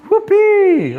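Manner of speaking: high, loud sarcastic whoop; mock celebration. Fired worker mocking his new boss, sarcastic whoop. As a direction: cheer like it's great news. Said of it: "I was celebrating with him".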